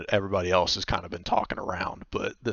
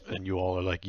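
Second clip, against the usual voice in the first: normal talking voice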